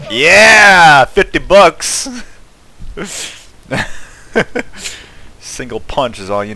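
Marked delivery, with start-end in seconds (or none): 0.1-2.1 loud, high whoop of victory; triumphant cheer. Real, not acted. Player cheers his bonus in the game, triumphant shout. 2.1-5.4 high, unvoiced giggling; gleeful laugh. Real, not acted. Player laughs after winning fifty bucks, gleeful.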